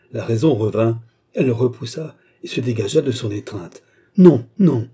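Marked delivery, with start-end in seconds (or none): 4.2-4.9 high, loud frantic refusal; cry of refusal. Acted. Jeanne crying out against her seducer, loud and high. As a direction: push him away with your voice.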